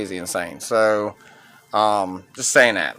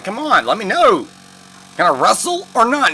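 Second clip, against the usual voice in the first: higher, pushier mock demand; retold impatient demand; 7 semitones higher than usual. Wrestler pushing the physician to clear him, impatient. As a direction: press the doctor hard for an answer. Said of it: "he's like".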